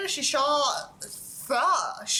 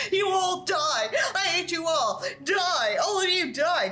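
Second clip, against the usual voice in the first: furious scream; 7 semitones higher, slower than usual. A narrator's reading. Giant hero screaming hatred at the surrounding aliens. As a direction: roar your hatred at them. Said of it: "I screamed again".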